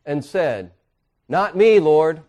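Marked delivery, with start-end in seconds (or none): 1.3-2.2 loud emphatic protest. Retold or imitated. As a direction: insist hard, louder. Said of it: "He said it emphatically".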